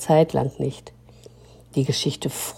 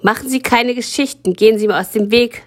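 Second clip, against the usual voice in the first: high, fast and louder, snapping; brusque dismissal; 7 semitones higher, 4 dB louder, faster than usual. Acted. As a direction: wave her off sharply. Frau Ringeisen brushes off story.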